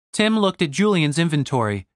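usual speaking voice

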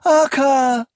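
high, loud call after someone leaving; desperate high-pitched cry. A narrator's reading. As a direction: call the name out with abandon. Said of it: "she cried out, not caring who heard".

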